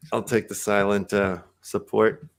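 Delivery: ordinary delivery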